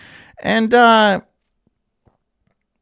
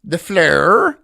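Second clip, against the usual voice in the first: louder, exaggerated Australian "flow" with a heavy R; mock Australian accent; 3 semitones higher, 6 dB louder than usual. Streamer echoing the complaining woman, mock Australian accent. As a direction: mimic the Australian vowel in "flow". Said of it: "Have you noticed the Australian accent?".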